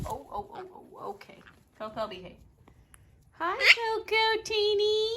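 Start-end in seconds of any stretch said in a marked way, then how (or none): 3.3-5.1 high, slow sing-song cooing at the dog. Real, not acted. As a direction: stretch out each word sweetly for the puppy.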